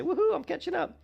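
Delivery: high, squealed playful cheer; mock celebration. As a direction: whoop it up, pitch leaping high. Imagined cheer on catching up.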